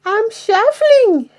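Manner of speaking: high, timid little voice; very small voice. Acted. Troll answering the jeering pirate, very small voice. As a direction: shrink your voice to nothing. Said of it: "in a very small voice".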